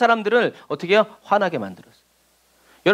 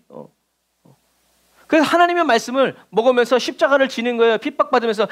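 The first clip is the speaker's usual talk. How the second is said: ordinary talk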